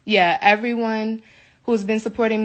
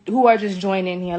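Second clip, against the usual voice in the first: ordinary talk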